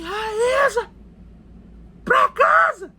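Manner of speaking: put-on high, loud bellow calling a child home; shouted summons, pitch way up; imitated father's shout. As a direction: mimic a stern parent yelling an order. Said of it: "ele gritava assim".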